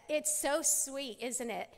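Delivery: ordinary talk, nothing marked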